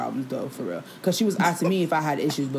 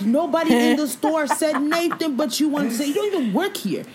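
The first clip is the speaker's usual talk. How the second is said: raised, higher-pitched comeback, slowed down; heated retort; 8 semitones higher than usual. Retold or imitated. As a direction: fire back at her, pitched up. Retelling her angry reply.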